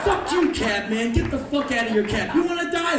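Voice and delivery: mock rage at the cab driver; furious shout. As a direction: scream threats at the cabbie.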